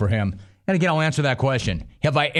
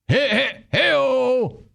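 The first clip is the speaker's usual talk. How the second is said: mock party cheer; 12 semitones higher, much slower than usual. Host mocks the coach's boozing with a high party whoop.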